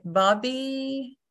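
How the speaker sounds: normal talking voice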